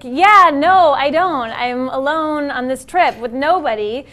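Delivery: exasperated retort. Retold or imitated. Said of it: "And I'm like".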